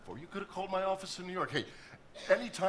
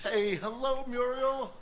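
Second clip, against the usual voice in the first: excited greeting; 9 semitones higher, 6 dB louder, slower than usual. Acted. Warm greeting of old girlfriend.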